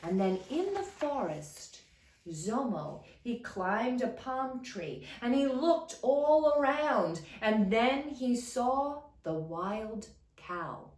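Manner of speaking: ordinary delivery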